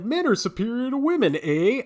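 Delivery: lightly put-on Canadian sitcom dad; badly faked Canadian accent; mock Canadian accent. Host mimics a sexist sitcom character, mock Canadian accent. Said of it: "I don't know why he's Canadian".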